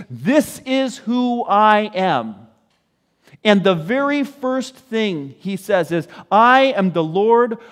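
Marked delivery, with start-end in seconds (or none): 0.1-2.5 slow, drawn-out, higher proclaiming; proclamation. Retold or imitated. Preacher voicing the Lord before Moses, proclaiming. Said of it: "he proclaims". 6.3-7.6 loud, high, stretched-out declaration; proclamation. Retold or imitated. Preacher voicing God's first words to Moses, proclaiming. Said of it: "the Lord comes and he proclaims".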